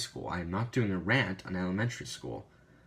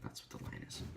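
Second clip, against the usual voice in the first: ordinary talk, nothing marked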